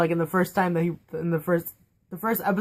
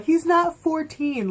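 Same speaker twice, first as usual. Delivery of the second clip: mock fan complaint; 9 semitones higher, much slower than usual. Mocking early fan complaints.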